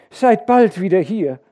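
hoch und laut hinterherrufen; mahnender, heller Zuruf der Mutter; Mutter ruft nach. A narrator's reading. (besorgter Mutterruf)

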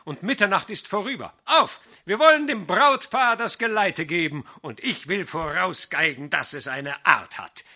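loud, high rallying call. A narrator's reading. Fiddler calling the band to set off, loud rallying shout. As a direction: rouse the whole company to leave. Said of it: "rief er".

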